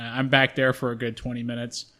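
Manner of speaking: normal talking voice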